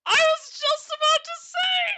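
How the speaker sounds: real high, loud, drawn-out outburst; excited shout. Real, not acted. Streamer reacts to chat beating them to it, excited shout. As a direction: shout it out, pitch soaring, words stretched. Outburst after chat's organ remark.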